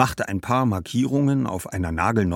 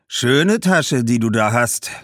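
loud, raised, admiring merchant; covetous shoemaker; 6 semitones higher, 7 dB louder than usual. Acted. Shoemaker eyeing the girl's bag for a trade, covetously. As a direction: admire it with designs on it.

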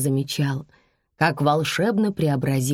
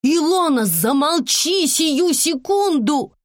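high, loud scolding; mother at breaking point, high and slow; angry order; 11 semitones higher, 4 dB louder, slower than usual. A narrator's reading. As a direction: shout down a child, stretching the command. Mother orders girl to stop.

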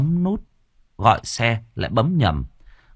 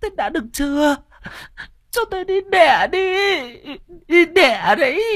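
very high, crying wail; wailing wife. Acted. Wife in labor wailing at her frozen husband, crying high. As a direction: howl and sob at once. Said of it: "mới gào lên".

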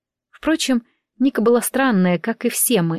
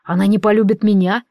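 plain reading voice

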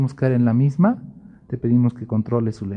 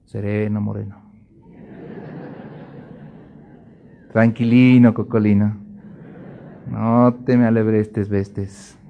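put-on calm them like a fussy child; soothing rhyme; 4 semitones lower than usual. A hint of a preacher soothing the calmed believer.

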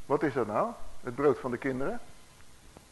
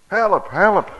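loud mock plea, raised pitch; acted cry for help; 5 semitones higher, 7 dB louder than usual. Preacher voicing the kneeling woman's plea, a raised cry. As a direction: call out for help, briefly acted. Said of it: "Ze begint te schreeuwen".

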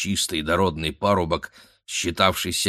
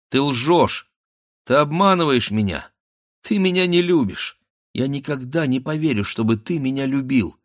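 higher-pitched accusing outburst; pitched up, pleading indignation; hurt reproach; 6 semitones higher, 3 dB louder than usual. Acted. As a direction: accuse him like a wronged sweetheart. Girl reproaching her suitor.

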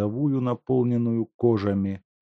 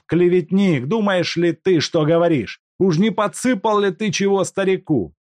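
angry accusation; 11 semitones higher, 7 dB louder than usual. Acted. Степанов обвиняет Куликова.

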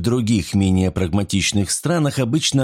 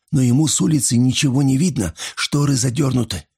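higher, edgy frightened voice; nervous trembling voice; 4 semitones higher than usual. A narrator's reading. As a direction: keep the nerves in every word. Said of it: "нервным дрожащим голосом прошептал Рейнольдс". (нервным дрожащим голосом)

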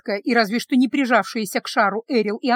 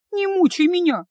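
high, loud pleading whine; wailing protest; 6 semitones higher, 5 dB louder than usual. A narrator's reading. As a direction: keep wailing, begging to be let off. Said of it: "взвыла Ива". (жалобно, высоко и громко)

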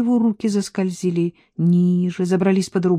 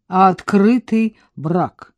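a touch of a mocking smirk, louder; 3 semitones higher, 8 dB louder, slower than usual. Man teasing his lover about her marriage, smirking. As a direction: toss the question with a grin. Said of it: "усмехнулся он".

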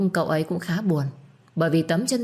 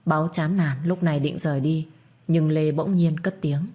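plain reading voice

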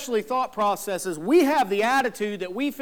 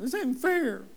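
put-on higher-pitched kid's whine on fair; whiny child; 5 semitones higher, slower than usual. Pastor mimics a child whining to parents, higher and whiny. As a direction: sulk it like a child denied something.

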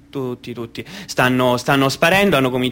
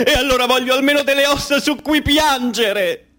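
desperate crying mother; 12 semitones higher than usual. Acted. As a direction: wail like a mother in a radio melodrama. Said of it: "E ora un tipico radiogram-, radiodramma argentino". (madre disperata che piange, voce alta e lenta)

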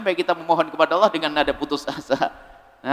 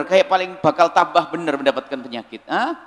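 normal talking voice

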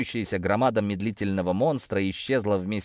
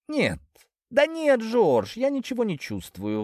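much higher, giddy denial; high tipsy voice; 12 semitones higher than usual. A narrator's reading. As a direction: squeak it out, happily drunk. Intoxicated cat denies.

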